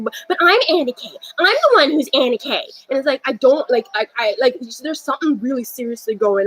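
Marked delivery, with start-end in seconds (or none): 0.3-2.7 put-on girl voice. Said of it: "some girl down the hall was talking".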